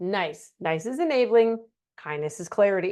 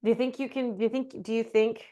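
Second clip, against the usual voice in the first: softer, hesitant team lead hedging a request; timid nice boss; 5 dB quieter than usual. Shown as a demonstration. Team lead gently asking an underperformer, softer and hesitant. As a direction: tiptoe around the criticism, softly. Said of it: "this is what nice sounds like".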